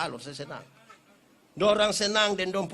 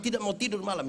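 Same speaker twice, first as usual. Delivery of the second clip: ordinary delivery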